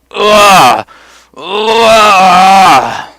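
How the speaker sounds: loud strained groans of pain; pained groan demo. Retold or imitated. Showing friend how he groaned on the floor, loud pained grunts. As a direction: groan out like your gut is torn. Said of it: "Like that".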